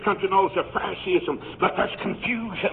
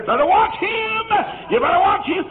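mock warning shout; 12 semitones higher, 4 dB louder than usual. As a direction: sound the alarm about him, hammed up. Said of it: "And they'll say".

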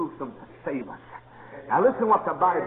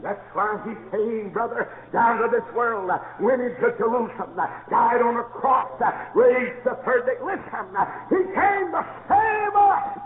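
higher, slow preaching; rising preaching; 6 semitones higher, slower than usual. Acted. Preacher builds toward the cross, higher and slower.

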